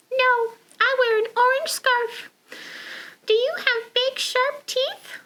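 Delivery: high little penguin voice. Acted. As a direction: answer as the penguin. Penguin and bear talk.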